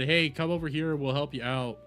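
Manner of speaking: normal talking voice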